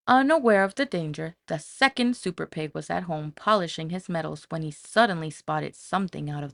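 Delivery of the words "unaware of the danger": ordinary delivery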